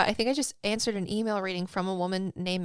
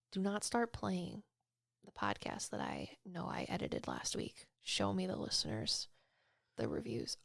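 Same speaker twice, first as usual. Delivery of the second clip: mutter to herself; 13 dB quieter than usual. Real, not acted. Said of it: "muttering to herself at computer".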